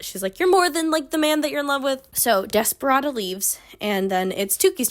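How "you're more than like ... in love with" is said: high, emphatic encouragement; pep-talking doctor. Retold or imitated. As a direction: imitate an earnest pep talk. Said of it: "gives her a pep talk".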